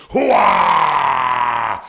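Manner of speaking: shouted Pacino hooah; loud gung-ho soldier. Acted. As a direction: bark it gung-ho. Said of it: "Here's his Al Pacino".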